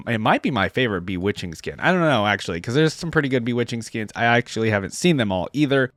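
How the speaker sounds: ordinary talk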